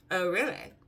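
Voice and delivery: dry, sarcastic "Oh, really?" with a rise on "really". Retold or imitated. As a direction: drop a deadpan "Oh, really?" that you don't mean. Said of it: "know me, being sarcastic".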